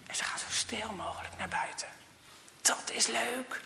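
hushed whisper, as if sneaking. A narrator's reading. Telling the children how they sneak outside, in a whisper. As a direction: whisper it so nobody hears.

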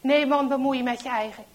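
loud, fast telling-off; irritated rebuff. A narrator's reading. Traitor tells Henk to mind his business, irritated. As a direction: push him away with brusque volume.

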